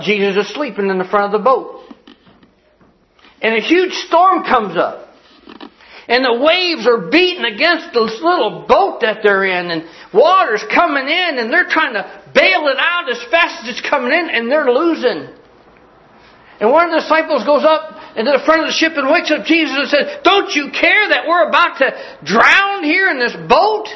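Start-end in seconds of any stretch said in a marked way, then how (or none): 3.4-20.2 high-pitched, urgent storm storytelling; dramatic preaching. Real, not acted. Preacher telling how a storm swamps the disciples' boat, rising urgent preaching. 20.2-23.9 high, loud, desperate plea; alarmed disciple. Acted. Disciple waking Jesus in a sinking boat, alarmed high cry.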